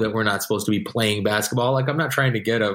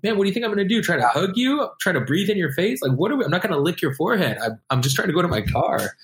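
mock exasperation; 8 semitones higher than usual. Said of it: "I'm like".

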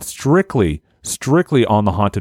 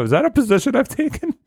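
ordinary delivery

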